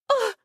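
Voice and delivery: short high startled gasp; sharp gasp. Acted. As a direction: gasp as if caught off guard. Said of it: "I gasped".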